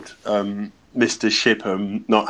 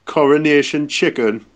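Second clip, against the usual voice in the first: put-on Geordie accent. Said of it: "do them again in the Geordie accent".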